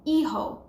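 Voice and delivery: hijo with a silent h, said ee-ho; Spanish hijo. Shown as a demonstration. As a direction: clear teacher model of hijo. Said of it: "So son is".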